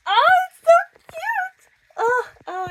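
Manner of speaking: very high, loud squeal of delight. Real, not acted. Reader overcome by a cute love confession, delighted squeal.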